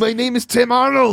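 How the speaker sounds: Arnold Schwarzenegger. Acted. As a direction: announce yourself like an action star. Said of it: "your character's name".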